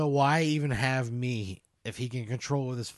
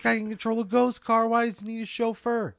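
higher, indignant complaint; pitched-up, put-upon griping; exasperated protest; 7 semitones higher, 3 dB louder than usual. Acted. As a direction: protest loudly at being dragged into this. Player protests being dragged in.

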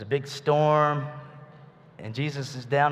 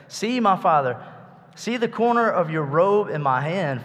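loud, higher calling out; yelling; 5 semitones higher, 5 dB louder than usual. Retold or imitated. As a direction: call out to Saul from afar. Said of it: "He was yelling at him".

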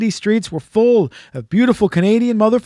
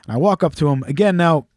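ordinary delivery

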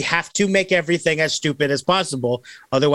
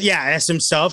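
ordinary delivery

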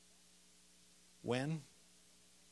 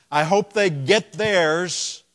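spiteful; 5 semitones higher, 12 dB louder, slower than usual. Retold or imitated. Mock hateful believer quote.

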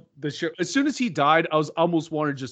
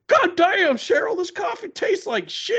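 sheriff impression; slower than usual. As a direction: imitate an oddball movie sheriff griping, pitched up. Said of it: "When he's sitting there, he's like".

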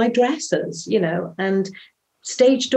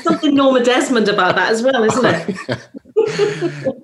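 laughing; 4 semitones higher, 3 dB louder than usual. Real, not acted. Laughing at a joke.

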